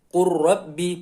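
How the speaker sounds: "Qur Rabbi" slowly, l dropped, r doubled; lam merged into ro. Shown as a demonstration. Teacher shows lam vanishing into ro, slow merged recitation. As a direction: run "Qur Rabbi" together on a long rolled r. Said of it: "Jadi langsung".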